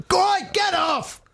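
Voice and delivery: high, drawn-out mock yell; slow, strained toddler shout; disgusted young daughter. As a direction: impersonate an outraged child refusing tickles. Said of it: "In that very low voice as well".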